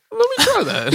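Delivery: very high, prissy posh voice; rich guy voice. Retold or imitated. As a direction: squeak it out like a fancy snob. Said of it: "He's like".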